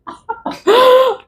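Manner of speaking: a sharp, high cackle of glee; high gleeful laugh. Real, not acted. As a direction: laugh at their bad luck. Laughs at their misfortune.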